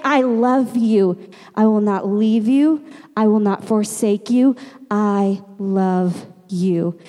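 slow, deliberate loving assurance; earnest comfort, each phrase set apart; solemn tender promise. Acted. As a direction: promise it steadily, slowing right down at the end. God's loving promise, slow and low.

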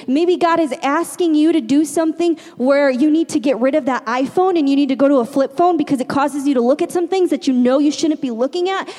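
ordinary delivery

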